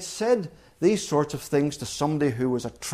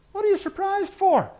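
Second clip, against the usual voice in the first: incredulous challenge. Acted. Preacher voicing Jesus' rebuke.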